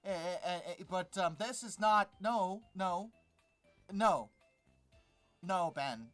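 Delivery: ordinary talk, nothing marked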